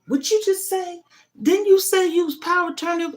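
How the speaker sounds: high, incredulous interrogating voice; mock official challenge. Mother mimics official doubting her power of attorney, shrill incredulous challenge. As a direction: play the suspicious official pouncing on her.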